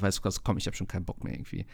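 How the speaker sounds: quick, unvoiced, giving-up mutter; fed-up mutter. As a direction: mumble it like you've given up. Fed up with TV deals.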